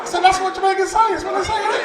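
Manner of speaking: high-pitched comic protest; mock protest. Actor defending his line to the crew, high protesting.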